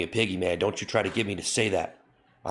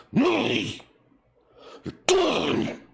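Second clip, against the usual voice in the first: squeaky outburst; 3 dB louder, much slower than usual. Acted. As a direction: crack into a silly squeal at the end. Sudden squeaky punchline.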